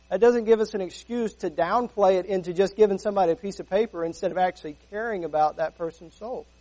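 ordinary talk

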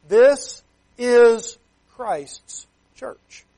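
This is Christ's Church drawn out very slowly, every word weighted; slow emphatic. Acted. Preacher proclaims the sermon title to his church, slow and weighty. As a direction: lean on each word slowly.

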